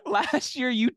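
laughing while teasing. Real, not acted. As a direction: tease him through laughter.

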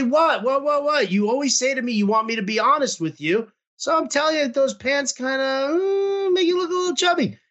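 high, fast mock-innocent "what, what, what"; mock innocent protest. Feigned innocence to angry wife.

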